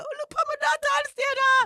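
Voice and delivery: put-on high, loud calling out in a Jamaican accent, building to a shout; mum's Jamaican accent. Imitating her Jamaican mum crying out on stage, loud high shout. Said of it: "I keep doing this accent".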